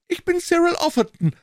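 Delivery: put-on high, eager young man's voice; Offerton chiming in. A narrator's reading. Offerton interrupting Holmes with a suggestion, high and eager.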